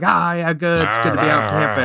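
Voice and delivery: mock gruff old camper; lightly put-on gruff dude voice; rough, gruff man talk. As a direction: imitate guys trading manly small talk. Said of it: "a rough and a gruff".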